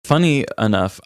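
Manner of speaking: ordinary talk, nothing marked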